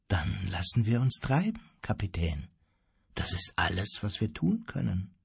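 leise und tiefer, ganz gelassen; sanft, unbewegt. A narrator's reading. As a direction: sanft und gleichmütig antworten, mit gesenkter Stimme. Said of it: "McCoy erwiderte sanft, ohne eine Miene zu verziehen". (ruhig, sanft, ohne jede Regung)

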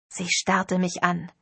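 ordinary delivery

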